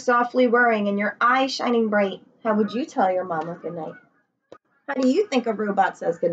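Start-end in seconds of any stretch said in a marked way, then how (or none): none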